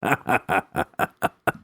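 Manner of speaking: a head-back villain's laugh; bellowing laugh. A narrator's reading. Bishop laughing at the captive's line, bellowing laugh. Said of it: "bellowed with laughter".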